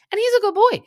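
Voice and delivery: very high, loud squeal; gushing dog praise. Real, not acted. Host defending Graham the dog, high-pitched gushing. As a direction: coo loudly in a soaring pet-talk voice.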